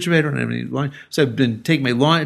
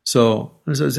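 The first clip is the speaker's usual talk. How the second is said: normal talking voice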